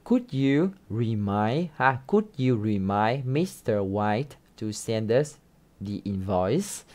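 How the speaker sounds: slow, voice rising on "invoice?" at the end; rising tune on invoice. Shown as a demonstration. Teacher modelling the question with a rise on "invoice". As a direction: lift the last word "invoice" up as a question. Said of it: "nhấn vào câu cuối ha, lên giọng ở cái chữ cuối cùng là invoice".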